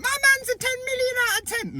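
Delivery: high, squeaky mocking voice of the commenter; mock defensive woman. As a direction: parody her as a shrill, defensive woman. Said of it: "you're like".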